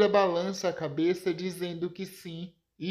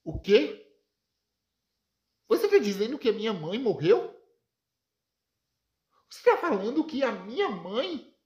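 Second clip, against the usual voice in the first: shocked; 5 semitones higher, faster than usual. Acted. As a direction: climb into stunned disbelief. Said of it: "fica chocado e pergunta". (pai chocado com a morte da mãe, cada vez mais alto e agudo)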